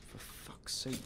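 ordinary delivery

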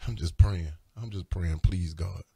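low, subdued plea to God; weary, low murmured plea; heavy-hearted prayer. Real, not acted. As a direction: sink low after a sigh, praying. Sighs, then prays after long pause.

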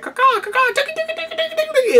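high squawking bird calls; bird call imitation. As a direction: caw and cluck like a silly bird. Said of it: "Sean William Scott's like".

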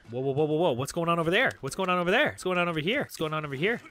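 urgent alarm. Real, not acted. Gamer to a commotion ahead, urgent and alarmed. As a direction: call out urgently, over and over.

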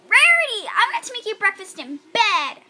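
high, loud squeaky filly voice; Sweetie Belle voice. Acted. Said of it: "I can show you my Sweetie Belle".

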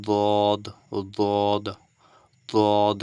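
দদ said loud and drawn out from the tongue's side edge; Arabic letter dad. Shown as a demonstration. Teacher modelling দদ for students, loud and deliberate. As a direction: model দদ clearly for learners, tongue's side against the upper molars. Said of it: "একটু try করুন".

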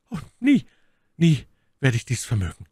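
high, pained cry of never; lady's anguished refusal. A narrator's reading. My Lady voiced by narrator. (My Lady weigert sich verzweifelt, hoch und laut)

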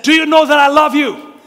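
firm loud reassurance. Retold or imitated. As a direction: get through to a panicking boy. Father reassuring son firmly.

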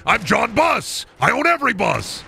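high, slow, self-important boast; pompous proclamation. Acted. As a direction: declare it like a ridiculous tycoon. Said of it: "And then I say".

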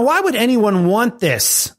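ordinary talk, nothing marked

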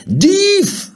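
very high, loud yell; shouted alarm. A narrator's reading. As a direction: cry out on spotting a thief. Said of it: "riep hij".

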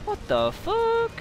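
very high, stretched-out protest; high-pitched outrage. Real, not acted. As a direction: squeal in slow, drawn-out disbelief. Outraged at an enemy spawn.

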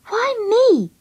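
high, indignant question; scowling protest. A narrator's reading. Friend resenting being sent for milk, indignant. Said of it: "I scowled at her".

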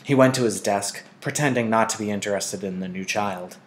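ordinary delivery

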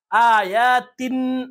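slow chanted آیاتن closing on n; unchanged tanween n. Shown as a demonstration. Teacher showing how not to recite, آیاتن with n. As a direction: draw out آیاتن, tongue landing on n. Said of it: "ایسے نہیں پڑھیں گے".

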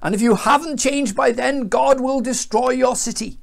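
high, projected proclamation; acted declamatory warning; prophet's warning. A narrator's reading. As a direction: threaten the crowd with judgement. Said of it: "he warned them".

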